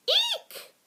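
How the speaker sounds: tiny, extremely high mouse squeak. A narrator's reading. Mouse suddenly face to face with gopher, squeaking. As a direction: squeak it in startled alarm. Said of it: "squeaked Ralph".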